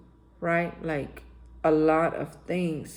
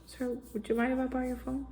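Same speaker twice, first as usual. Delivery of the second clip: soft, higher, polite request; real nice; 5 semitones higher, 9 dB quieter, faster than usual. Retold or imitated. Asking to borrow the pastor's phone, put-on nice and soft. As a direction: put on your nicest asking voice. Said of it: "real nice".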